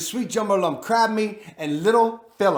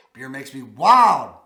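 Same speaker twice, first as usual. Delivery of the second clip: mock wild; 6 semitones lower, 8 dB louder, slower than usual. Joke about beer.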